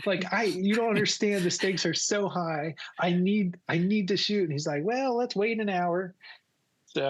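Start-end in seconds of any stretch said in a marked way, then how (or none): 0.1-4.4 high, stammering plea; retold pleading. Retold plea to pilot to fly. 4.8-6.1 high pilot voice; pilot impression. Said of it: "that's how he talked".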